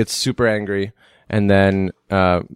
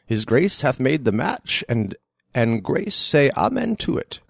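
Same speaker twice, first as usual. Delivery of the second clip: Shakespearean father; 8 semitones higher than usual. Acted. Father gives Count his daughter's hand, theatrical. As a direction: act it as a formal blessing. Performs Hero's father in excerpt.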